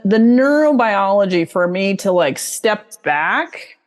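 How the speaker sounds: normal talking voice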